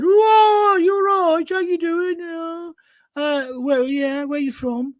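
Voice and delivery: high, sing-song Brummie greeting, drawn out; hammed-up Birmingham accent, loud opening then easing off. Brummie customer greeting the delivery driver, hammed-up Birmingham accent. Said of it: "Brummies ... that terrible accent".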